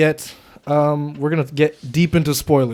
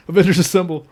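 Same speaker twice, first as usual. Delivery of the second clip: raised, louder movie-hero command; mock-epic call to the team; heroic rallying call; 5 semitones higher, 7 dB louder, faster than usual. Quoting the film's rallying line.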